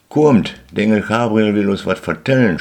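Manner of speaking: high, loud excited shout; children shouting. Acted. Children shouting to their parents, loud and high. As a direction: call out to the whole house, loud and high. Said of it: "schreien sie".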